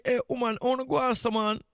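usual speaking voice

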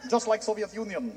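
deadpan Russian gloom; mock Russian accent, low and hushed. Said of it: "Russia, same thing".

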